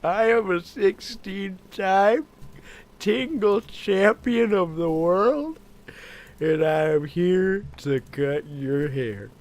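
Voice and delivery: slow, loud wrestling promo; wrestler promo voice. Pretend. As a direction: boast like a pro wrestler.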